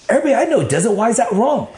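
high-pitched self-justifying plea; retold insistent objection; agitated protest. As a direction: argue with your conscience, voice way up. Retold agitated arguing with his conscience.

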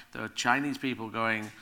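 ordinary talk

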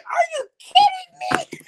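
learning what impressions were, exasperated and laughing; upset disbelief. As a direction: cry it out half laughing at yourself. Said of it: "I got more upset".

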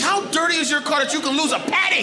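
incredulous shout. Retold or imitated. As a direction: scold the friend in disbelief. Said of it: "I said".

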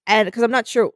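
ordinary talk

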